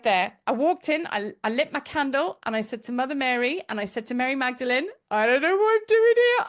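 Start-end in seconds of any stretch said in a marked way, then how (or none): 5.1-6.5 put-on high, loud, childlike cry to the saints; childlike wail. A lost child begging Mary Magdalene for help. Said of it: "I was very childlike".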